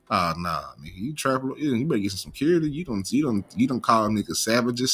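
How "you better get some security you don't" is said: ordinary talk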